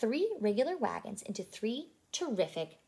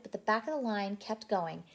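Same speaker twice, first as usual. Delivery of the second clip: usual speaking voice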